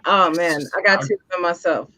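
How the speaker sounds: normal talking voice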